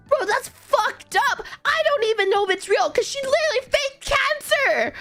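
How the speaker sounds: high-pitched, appalled reaction; retold incredulity. Retells own shocked reaction to friend.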